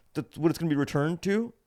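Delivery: high put-on clerk voice, ending up; explaining clerk. Clerk explaining refund card, mock clerk.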